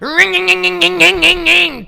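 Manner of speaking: high revving engine imitation; dirt bike engine noise. Shown as a demonstration. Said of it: "Check out my Honda".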